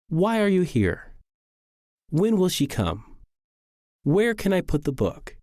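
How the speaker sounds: wh-questions dropping on "here", "come", "book"; falling intonation. Shown as a demonstration. Teacher reading example questions, each with falling intonation. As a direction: let "here", "come" and "book" fall at the end. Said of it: "They all end in falling intonation".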